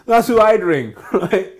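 high, loud laughter through the words; cracking up at his own joke; big laugh. Real, not acted. Laughing at his own NatWest joke, loud and high.

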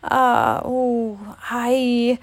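hesitant, drawn-out broken syllables; stammering. A narrator's reading. Piglet searches for an explanation, stammering. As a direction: falter as if hunting for words. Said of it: "Piglet stammered".

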